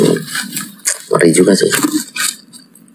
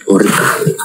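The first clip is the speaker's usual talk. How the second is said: whispered fear. Real, not acted. Frightened by the climbing animal.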